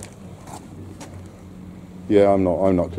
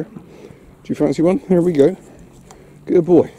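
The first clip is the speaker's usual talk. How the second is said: high, bright sing-song praise for the dog, louder; 11 semitones higher, 8 dB louder than usual. Real, not acted. Praising his dog Bear for sitting by the chair, high sing-song voice. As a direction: coo over a good dog.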